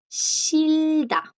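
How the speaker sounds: shilda drawn out, long first syllable; teacher's stretched shil-da; silent J. Shown as a demonstration. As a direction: model shilda slowly, R sounding as L and no h. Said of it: "no suena".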